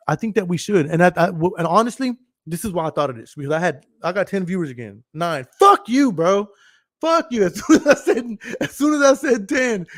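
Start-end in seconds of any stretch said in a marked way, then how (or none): 5.6-9.9 high, loud cursing through laughter; laughing mock outrage. Real, not acted. Streamer as the count drops to nine, laughing outrage. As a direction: yell at the quitter, cracking up.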